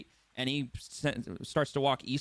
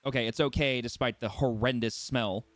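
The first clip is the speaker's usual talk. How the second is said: usual speaking voice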